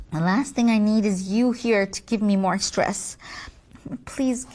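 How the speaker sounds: ordinary talk, nothing marked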